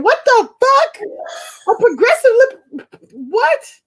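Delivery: high, loud, baffled yell; incredulous outburst. Acted. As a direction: blurt it in appalled disbelief. Acted disbelief at date's answer.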